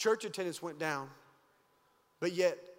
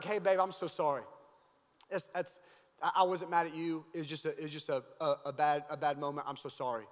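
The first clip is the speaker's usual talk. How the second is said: apologetic wife; 5 semitones lower than usual. Retold or imitated. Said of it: "She's like".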